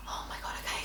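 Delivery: tiny whispered acceptance; barely audible, voiceless reply; subdued whisper. Retold or imitated. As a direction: whisper it, giving in. Retelling holding back her crying.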